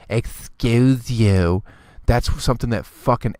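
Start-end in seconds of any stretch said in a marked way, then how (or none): 0.1-1.6 slow, haughty rising "Excuse you"; drawn-out stuck-up put-down; snotty "excuse you". Retold or imitated. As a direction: sneer it like a prissy girl. Repeating the girl's put-down.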